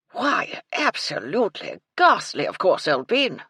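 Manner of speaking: put-on loud, gleeful old colonel; with relish. Colonel relishing the murder talk, loud and gleeful. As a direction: savour every gory word. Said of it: "the Colonel said with relish".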